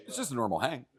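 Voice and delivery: just a hint of Pete brushing it off; Pete impression. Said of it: "a very Pete thing of being like".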